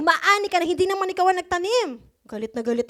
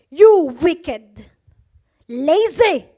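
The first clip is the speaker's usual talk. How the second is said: stern rebuke; 7 dB louder, much slower than usual. Acted. Master condemns the lazy servant.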